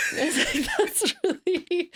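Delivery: high, breathy laughing through the words; can barely speak for laughing; laughing hard. Real, not acted. Guest giggling at the host's cheeky joke, high laughter.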